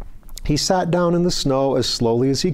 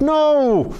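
sharp, much higher, louder yelp; panicked cry; 4 dB louder than usual. A narrator's reading. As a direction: shout it in alarm. Claude clinging high on tree.

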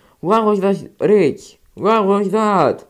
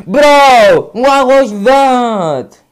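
loud, higher, slow; outraged shout; 8 semitones higher, 8 dB louder, much slower than usual. Real, not acted. Gamer yelling at a cheat. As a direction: drag it out in outrage.

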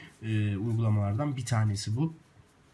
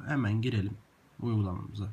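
usual speaking voice

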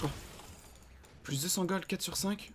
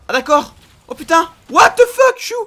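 rising, stunned yells, much higher and louder; shocked shouting; 12 semitones higher, 16 dB louder than usual. Real, not acted. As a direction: blurt out total disbelief. Chaotic game moment, shocked.

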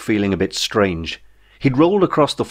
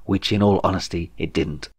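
ordinary delivery, nothing marked

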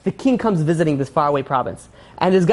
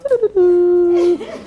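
high imitated cowboy whistle; piercing mock whistle; 12 semitones higher, 3 dB louder than usual. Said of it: "with a cowboy whistle".

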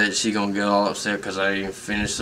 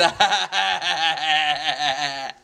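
big laugh; 5 semitones higher than usual. Real, not acted. Laughs after calling out.

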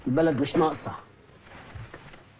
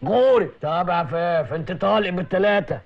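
loud, harsh commands; barking orders; 7 semitones lower, 4 dB louder than usual. Acted. Officer shouting at his wife and men, loud barking. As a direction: bark it like a bullying officer.

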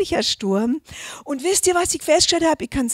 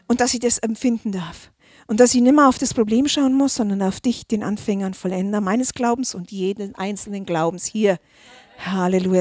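intimate thankful prayer; 4 semitones lower than usual. Acted. Closing prayer to God.

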